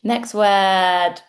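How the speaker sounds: ordinary talk, nothing marked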